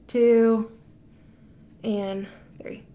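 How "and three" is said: whispering. Real, not acted. Countdown before pulling strip.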